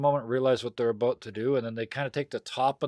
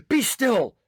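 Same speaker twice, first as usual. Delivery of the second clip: louder, higher order to a dog; raised-voice scolding of a pet; sharp command; 12 semitones higher, 8 dB louder than usual. Acted. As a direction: call out a firm command to an unruly dog.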